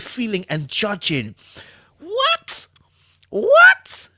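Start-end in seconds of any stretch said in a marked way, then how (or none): 2.0-4.1 high, shrieking disbelief; baffled what. Real, not acted. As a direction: squeal it in confusion. Confused by acronym.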